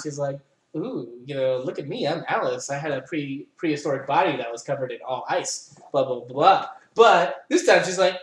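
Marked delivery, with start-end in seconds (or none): 0.8-6.7 a light mocking take on a sultry, bored Alice; sexy and nonchalant. Alice showing off in the first playthrough, lightly mocked sultry. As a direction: lower and softer, lazy seductive purr. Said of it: "sexy and nonchalant".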